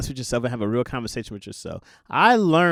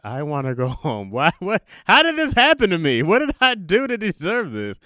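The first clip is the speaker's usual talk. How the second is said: mock whining; 5 semitones higher, 5 dB louder than usual. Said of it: "I was like".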